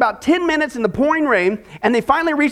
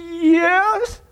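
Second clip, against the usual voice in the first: mock shaky, higher-pitched answer; 6 semitones higher than usual. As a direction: answer nervously, as if scared. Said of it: "and shakily says".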